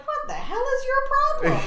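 very high, incredulous mimicked child; high-pitched mock indignation; indignant kid. Voices niece's baffled reaction.

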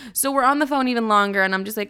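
normal talking voice

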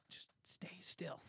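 tense breathless whisper. Retold or imitated. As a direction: hiss it without moving a muscle. Frozen after dipping elbow in beer.

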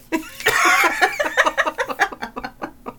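high, rolling laughter; long laugh. Real, not acted. Laughs at their acted goodbye.

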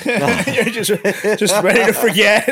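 high laughing tease. Real, not acted. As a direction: giggle through the words.

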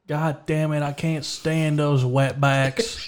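high, drawn-out mock griping; mock exasperation. Shopper pranking strangers at Walmart, mock exasperation. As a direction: gripe for everyone nearby to overhear. Acts loud prank outburst in store.

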